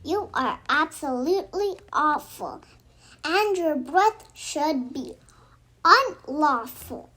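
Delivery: ordinary delivery, nothing marked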